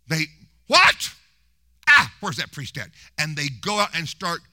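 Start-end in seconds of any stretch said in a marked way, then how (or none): 0.7-2.9 angry outburst. Retold or imitated. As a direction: explode like an enraged peasant hunting the priest. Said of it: "And they get mad".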